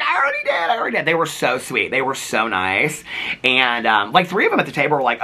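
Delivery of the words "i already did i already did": squeaky-high imitated fan; put-on high excited exclamation; retold excited reply. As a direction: imitate an eager fan answering back, pitched way up. Retold fan calling back excitedly.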